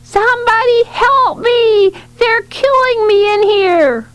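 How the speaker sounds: high, slow, drawn-out cry for help; acted child's yell. A narrator's reading. Ashley hangs out of window, high drawn-out acted yelling. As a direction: yell it high like a melodramatic child, stretching the words. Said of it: "of the window yelling".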